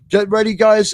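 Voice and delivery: ordinary delivery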